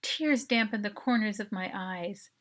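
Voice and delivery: plain reading voice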